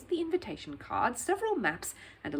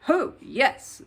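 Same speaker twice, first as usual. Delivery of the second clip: higher, louder mock agreement; sarcastic; 6 semitones higher, 9 dB louder than usual. A narrator's reading. Gatekeeper mocks Paddington's claim to see Sir Huntley, sarcastic. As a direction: drip with scorn on the yes. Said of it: "said the gatekeeper sarcastically".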